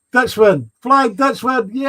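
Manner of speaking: loud, high welcoming whoop; excited cheer. Real, not acted. Streamer hailing Flying Dutchman joining chat, excited cheer. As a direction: cheer the arrival, pitch soaring.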